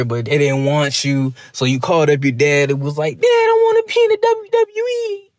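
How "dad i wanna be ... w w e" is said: put-on high pleading kid voice asking dad; mock whiny kid. Wrestler mocked as a kid begging dad to join WWE, high whine. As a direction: whine it up like a boy begging his father. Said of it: "That's how he said it".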